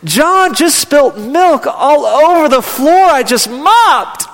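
high, drawn-out tearful wail; crying child. Retold or imitated. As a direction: wail it slowly like an upset little girl tattling. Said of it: "she's crying".

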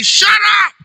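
high-pitched sharp silencing call; high, imitated scolding; mock rebuke. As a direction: act out a crowd telling someone to shut up. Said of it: "The people rebuked him".

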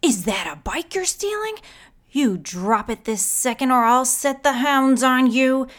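furious neighbour threatening the father; angry threat. A narrator's reading. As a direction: make her threat land hard.

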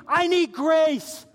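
loud, high, slow cry; imitated desperate scream from the pulpit; screaming plea. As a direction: belt out the need, stretching it. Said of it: "they're screaming".

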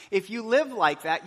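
ordinary talk

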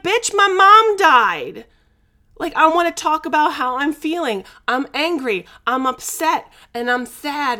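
loud, high, furious retort; angry outburst. Retold or imitated. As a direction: let the anger burst out at the coach. Retold angry outburst at coach.

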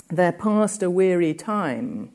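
slow "There passed a weary time", drawn out; slow, weary line. Shown as a demonstration. Lecturer slowing the line to show the weariness, drawn out. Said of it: "By delaying time, we get some sense of the slowness".

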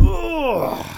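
high strained "ugh" grunt; bending-over groan. Real, not acted. Groaning as he bends over, getting older.

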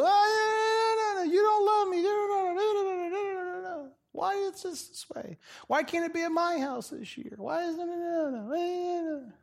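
very high, wailing mock crybaby; high, sobbing mock tantrum fading to a whimper. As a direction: whine and wail like a spoiled crybaby. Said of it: "the crybaby".

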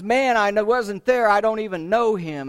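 loud, high-pitched insistent protest; heated denial. Acted. Peter to his accuser after the third accusation, heated denial.

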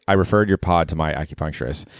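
ordinary delivery

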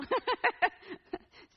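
loud, high burst of laughter. Real, not acted. Speaker joking about a crazy mom, loud laughing. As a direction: laugh out loud at your own joke.

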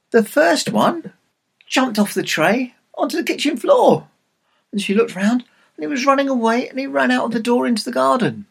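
high-pitched storyteller's alarm; breathlessly exciting escape, told high; excited narration. A narrator's reading. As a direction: pitch jumps up as the chase begins.

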